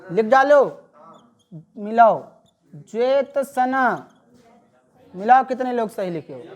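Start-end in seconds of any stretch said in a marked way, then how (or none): 2.7-4.1 ज्योत्सना said slowly, jyot-sa-na; ज्योत्सना with the त्स cluster clear; slow model word. Shown as a demonstration. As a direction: spell out ज्योत्सना syllable by syllable. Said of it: "ज्योत्सना हो जाएगा".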